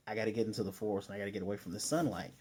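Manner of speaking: a fleeing demon gabbling his plan; hurried demon quote. Retold or imitated. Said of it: "and the guy's like".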